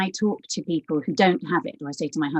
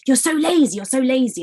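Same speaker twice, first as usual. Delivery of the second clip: higher, louder mock scolding; berating; 8 semitones higher, 5 dB louder than usual. Teachers and parents criticising her as a child, berating. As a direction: imitate a critical grown-up telling off a child. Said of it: "And it was berating voice".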